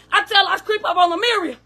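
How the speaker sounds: ordinary talk, nothing marked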